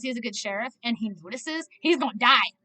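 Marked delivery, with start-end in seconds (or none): none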